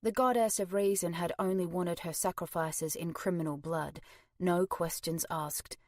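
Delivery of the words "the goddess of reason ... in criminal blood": ordinary delivery, nothing marked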